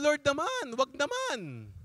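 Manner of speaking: high-pitched mock plea to God; pleading bargain. Priest bargaining with the Lord, pleading. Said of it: "nagbabargain pa ako sa kanya".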